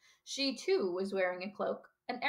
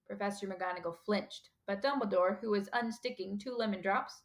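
plain reading voice

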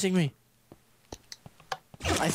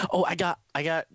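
ordinary delivery

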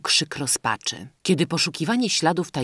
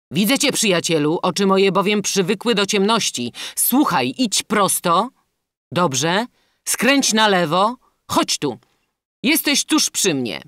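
loud, higher-pitched guiding voice; urgent, raised princely instructions; captive prince; 7 semitones higher, 7 dB louder than usual. A narrator's reading. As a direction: call directions to a man in the dark. Captive prince guides the bandit.

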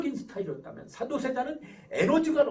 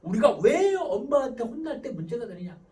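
ordinary talk, nothing marked

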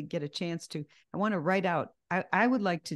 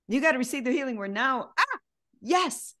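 joyful; 6 semitones higher, 4 dB louder than usual. Acted. Acted joyful receiving.

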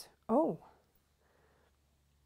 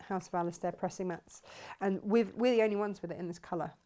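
ordinary talk, nothing marked

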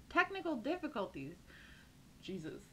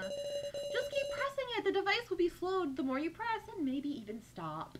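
creepy villain voice; 6 semitones higher than usual. Acted. Villain explains the button to Sarah, creepy and high. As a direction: savor the cruelty as you explain.

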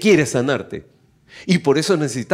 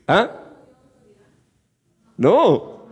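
ordinary talk

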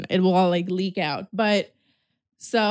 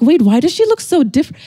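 higher, loud disbelief, less laughter; shocked double take; 6 semitones higher, 6 dB louder than usual. Retold or imitated. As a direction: repeat it in stunned disbelief. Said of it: "I'm like".

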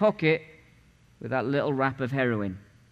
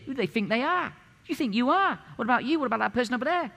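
higher pitched indignant outburst; mock raging; 9 semitones higher, faster than usual. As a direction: fire off the questions like an offended hothead. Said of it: "I mean, raging".

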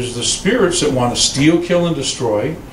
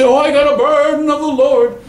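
high, louder mock-pious wail; mocking burdened believer; 11 semitones higher, 4 dB louder than usual. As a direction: parody the dramatic burden claim. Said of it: "So if you say".

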